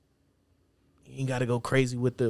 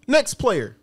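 much higher and louder cartoon announcer; mock game announcer; 12 semitones higher, 7 dB louder than usual. Joking between topics, high mock game announcer. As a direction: yell it like an arcade voice.

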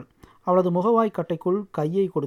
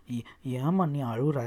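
slow, soft, lower, stumbling over the first word; halting child's question; 4 semitones lower, 5 dB quieter, slower than usual. A narrator's reading. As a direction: stammer it out slowly and softly. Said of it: "திக்கி திணறி அதை கேட்டது".

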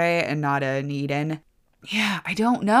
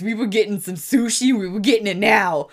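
mock urgency; 4 semitones higher than usual. Mock urgency about dash for sushi.

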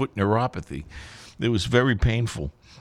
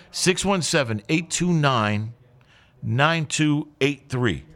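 slow and higher, each digit pressed; announcer giving the phone line, slow and emphatic; slow, spelled-out phone number; 4 semitones higher, slower than usual. Real, not acted. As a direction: read the number slowly so callers can write it down. Radio DJ reading out call-in number.

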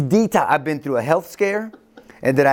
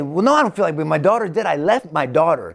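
higher-pitched, heated objection; indignant protest; 4 semitones higher, 3 dB louder than usual. Acted. Jairus protesting that he left his daughter, indignant. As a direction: argue back, pitch jumping up in protest.

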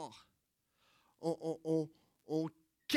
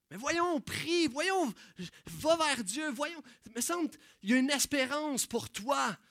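higher, pushy and impatient; mock exasperated preaching; 7 semitones higher, 5 dB louder than usual. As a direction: scold them toward God, half-joking. Preachy friend scolds the blind.

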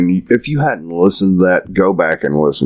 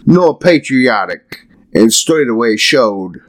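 put-on higher, declaiming old verse grandly; theatrical poem reading; 6 semitones higher than usual. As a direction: recite it like a pompous bard. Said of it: "reading old poem theatrically".

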